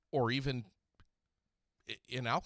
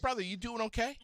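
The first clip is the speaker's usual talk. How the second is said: high, bright welcome to a caller; excited greeting; 12 semitones higher than usual. Real, not acted. Radio host welcoming caller Noah, pitched high and eager.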